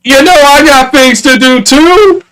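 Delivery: high-pitched, put-out complaint; acted indignant plea; exasperated protest. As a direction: whine it upward like you're being neglected. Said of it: "I'm just like".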